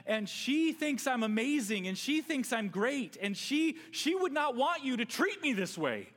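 loud, high, desperate husband begging; self-centred begging on the phone; pleading ransom call. Pretend. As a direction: plead for your wife back, all about yourself. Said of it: "I speak to them and I say".

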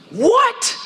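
high-pitched, drawn-up disbelieving "what"; shocked reaction. Acted. Preacher reacting to the Pharisees being named, mock shock.

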